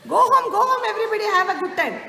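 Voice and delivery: loud, high, fast cheerful dismissal; officer shooing crowd. Retold or imitated. Officer sending shoppers home, loud, quick and cheerful. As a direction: rattle it off, waving everyone home.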